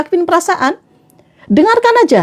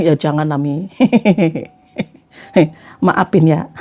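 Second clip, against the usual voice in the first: apologetic husband; 10 semitones lower than usual. Acted. Acts husband apologising to wife. (suami minta maaf, suara rendah pelan)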